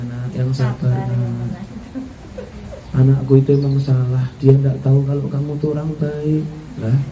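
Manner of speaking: put-on soft, gentle mother soothing a crying girl; tender comforting. Mother-in-law comforts her crying daughter-in-law, soft and tender. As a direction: hush her tears softly, like a warm hug. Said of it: "pelukan seorang ibu yang betul-betul hangat".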